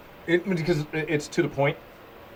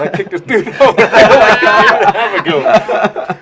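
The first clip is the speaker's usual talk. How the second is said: laughing through the words, louder and higher; 8 semitones higher, 9 dB louder, slower than usual. Real, not acted. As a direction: crack up while telling it. Laughing at his own memory.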